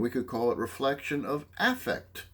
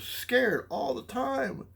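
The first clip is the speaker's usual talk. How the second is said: 1 scared client; 13 semitones higher, much slower than usual. Acted. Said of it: "So if somebody said".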